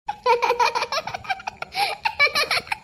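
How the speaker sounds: long, high-pitched laugh; laughter. Acted. Laughing after being told a groom of her liking will come, high laugh. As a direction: let out a long run of high giggling.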